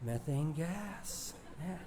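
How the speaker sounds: brotherly awe. Retold or imitated. Two brothers marvelling at their discovery: soft slow awe. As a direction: let the words fall out in wonder, barely breathed. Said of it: "we said in brotherly awe".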